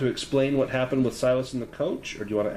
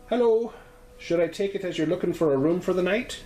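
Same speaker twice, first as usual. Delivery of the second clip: higher, lightly Irish landlady greeting; a friendly Irish landlady at the door; Irish lilt; 9 semitones higher, 3 dB louder than usual. Acted. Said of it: "Her voice has a slight Irish lilt".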